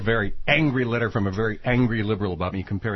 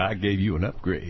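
creepy low voice; 7 semitones lower, 4 dB quieter than usual. Retold or imitated. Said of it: "And he said".

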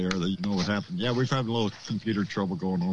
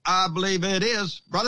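lightly put-on evangelist, pitched higher; mock preacher; 7 semitones higher than usual. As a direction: play at being a revival preacher. Mock evangelist joke after scam question.

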